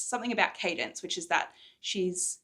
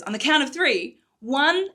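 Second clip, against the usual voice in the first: higher and louder, building count; 7 semitones higher, 11 dB louder than usual. Acted. Morrigan counting herself in, loud and keyed up. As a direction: psych yourself up to jump.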